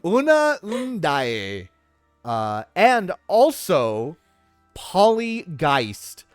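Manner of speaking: slow, booming showman introduction; announcer voice. Acted. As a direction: draw out each name like a ringmaster. Grand slow guest introduction.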